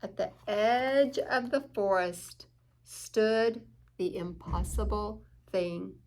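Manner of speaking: ordinary delivery, nothing marked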